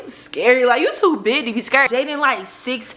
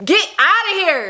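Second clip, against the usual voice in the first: high, incredulous; 10 semitones higher, 4 dB louder than usual. Retold or imitated. Incredulous mock.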